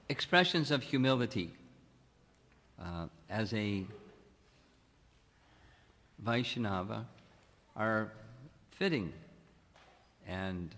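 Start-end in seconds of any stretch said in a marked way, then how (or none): none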